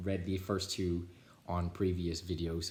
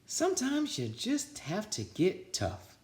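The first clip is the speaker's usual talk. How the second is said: much higher, slow, knowing tone; sly teacher; 12 semitones higher, slower than usual. A narrator's reading. Miss Nelson tells her sister Barbara, high knowing tone. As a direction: let the secret show.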